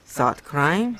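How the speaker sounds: usual speaking voice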